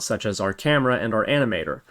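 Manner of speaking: normal talking voice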